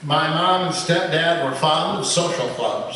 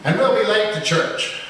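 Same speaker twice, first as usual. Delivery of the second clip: higher pitched donkey protest; a mild donkey bray; 6 semitones higher than usual. A narrator's reading. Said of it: "she brays".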